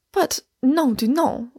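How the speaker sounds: mildly exasperated cry; testy. A narrator's reading. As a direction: object with a touch of irritation. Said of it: "I cried testily".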